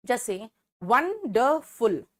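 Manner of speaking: very slow वंडरफुल split into won-der-ful; slow teacher demo of syllables. Shown as a demonstration. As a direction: spell out the syllables slowly. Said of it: "तीन शब्द खंड है".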